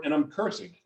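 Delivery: usual speaking voice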